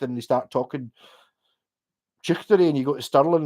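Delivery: usual speaking voice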